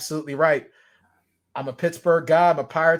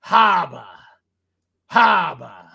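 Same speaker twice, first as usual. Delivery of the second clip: loud Boston dropped R; hammed-up Boston accent; bad Boston accent; 3 semitones lower, 8 dB louder, much slower than usual. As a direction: punch it out loud in broad Boston. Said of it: "saying things in a bad Boston accent".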